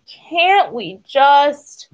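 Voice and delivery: high, drawn-out whiny moan. A narrator's reading. Goose pleads at the bottom of the hill, moaning. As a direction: stretch every word into a plaintive moan. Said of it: "Goose moaned".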